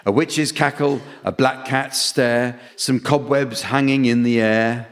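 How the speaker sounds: ordinary talk, nothing marked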